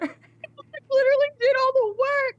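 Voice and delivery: high, loud, tearful protest; upset, near tears. Real, not acted. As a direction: let the hurt break through. Upset over the sacrifice story.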